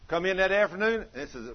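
usual speaking voice

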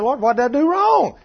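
high, loud mock wail to heaven; exasperated plea. Preacher acting husband asking the Lord, exasperated plea. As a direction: cry it upward, baffled and pleading.

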